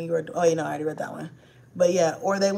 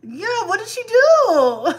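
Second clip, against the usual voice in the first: loud, slow, high fake excitement; mock amazement; 7 semitones higher, 6 dB louder, slower than usual. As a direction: ham up being thrilled for the kid. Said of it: "and I'm like".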